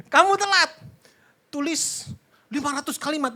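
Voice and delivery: high, loud telling-off; mock angry schoolteacher. Teacher punishing a latecomer, mock angry shout. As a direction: play a teacher ordering punishment lines. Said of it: "Kayak guru di sekolahan".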